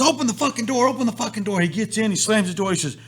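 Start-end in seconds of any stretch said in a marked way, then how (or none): 0.0-1.6 retold urgent demand. Retold panicked friend in rain.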